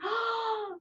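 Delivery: sharp delighted gasp; surprised gasp. Acted. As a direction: gasp as if spotting something. Discovering an animal.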